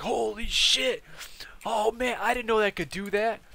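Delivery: high-pitched, hammed-up awe; amazed buddy impression. Buddy seeing what the mod could do, amazed. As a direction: imitate the buddy blown away, voice way up.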